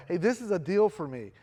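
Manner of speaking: normal talking voice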